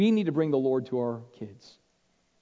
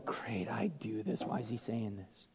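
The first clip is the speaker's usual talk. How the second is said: soft, slow unvoiced mutter to oneself; hushed slow listener's whisper; whispered inner grumble; 7 semitones lower, 13 dB quieter than usual. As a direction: breathe it as a guilty private thought. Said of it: "sitting there going".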